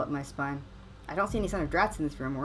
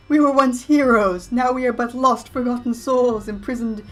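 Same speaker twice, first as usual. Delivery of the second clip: ghost voice; 6 semitones higher, 11 dB louder than usual. Acted. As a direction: moan it like a wronged ghost. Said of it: "ghost voice".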